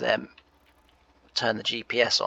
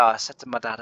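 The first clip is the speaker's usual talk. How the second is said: ordinary talk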